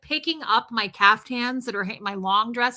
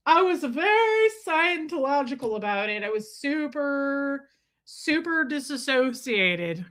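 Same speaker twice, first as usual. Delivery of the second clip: put-on, drawn-out detached voice; mock Scientological; 3 semitones higher, slower than usual. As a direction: stretch it slowly in an affected, dissociated voice. Mock Scientologist restating her own manner.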